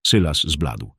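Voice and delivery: ordinary delivery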